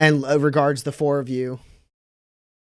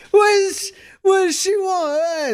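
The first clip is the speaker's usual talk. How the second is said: mocking onlookers; 5 dB louder than usual. Crowd laughing at the newcomer.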